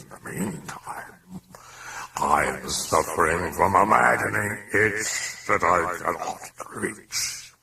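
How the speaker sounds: slow, drawn-out demon voice; sly, gloating demon. A narrator's reading. Demon amused by the sceptical priest, slow gloating. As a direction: savour the words, fading into whispery menace.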